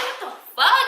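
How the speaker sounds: high-pitched baffled outburst; re-enacting a freaked-out reaction; retold freak-out. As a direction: pitch it way up in disbelief. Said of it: "I'm freaking the fuck out".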